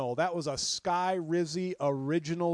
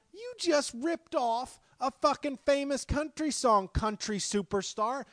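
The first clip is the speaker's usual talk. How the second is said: mock outrage; 10 semitones higher, 4 dB louder than usual. Ranting at the singer.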